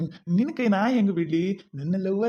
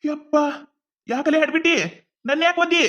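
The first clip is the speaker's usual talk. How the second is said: loud, higher cry of hurt protest; pained yell; 6 semitones higher, 3 dB louder than usual. Acted. Husband kicked by his wife, loud higher protest. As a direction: yelp it out after being kicked. Man kicked, yells in pain.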